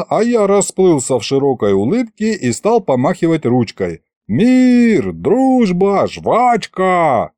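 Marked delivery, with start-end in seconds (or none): 4.3-7.3 high, singled-out slogan words; hammed-up friendly call. Waving at the wary officer to show peace, hammed-up call. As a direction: proclaim each word across a distance.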